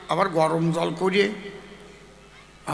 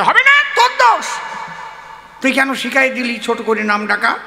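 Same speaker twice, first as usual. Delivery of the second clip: high, loud angry blame; furious accusation; 4 semitones higher, 5 dB louder than usual. Acted. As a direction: shout the blame at your friend. Beaten friend blames him. (মার খাওয়া বন্ধু রেগে চেঁচিয়ে দোষ দিচ্ছে)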